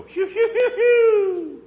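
high, loud whistling phew sounds, laughing through them; imitated whistle call. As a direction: mimic the boy's whistle call. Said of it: "all I'd have to do is go".

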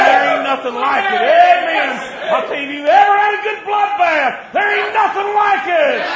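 high, loud revival shout; preaching at full force. Acted. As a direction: drive it home to the congregation.

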